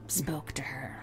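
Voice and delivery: hushed and breathy, like a campfire story; spooky whisper. Acted. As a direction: drop to an eerie whisper.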